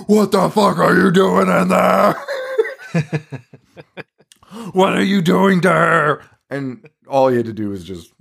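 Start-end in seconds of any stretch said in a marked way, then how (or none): 0.1-6.2 higher, slow mock-outraged yell; imitating Alan. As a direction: play Alan bellowing at the monkey, laughing through it. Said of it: "where Alan's like".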